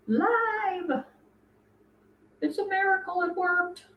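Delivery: high, drawn-out celebratory cry; excited cheer. Real, not acted. Celebrates the stream finally working.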